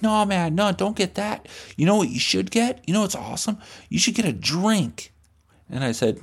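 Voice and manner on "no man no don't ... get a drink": put-on high, smooth pushy kid voice; high salesman-kid voice; pushy cool kid. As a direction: talk him into the drink, pitched up. Pushy kid steering him.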